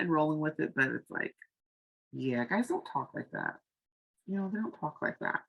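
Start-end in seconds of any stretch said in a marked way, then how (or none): none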